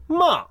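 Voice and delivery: まあ starting high, falling sharply; fourth tone. Shown as a demonstration. Teacher demonstrating fourth tone, falling まあ. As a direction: drop まあ fast from the top. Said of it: "これ第四声です".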